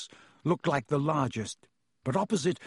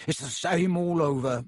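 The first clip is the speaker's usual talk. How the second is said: smooth, fawning shopkeeper voice; oily voice; 3 semitones higher than usual. Acted. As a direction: purr it ingratiatingly. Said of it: "in his oily voice".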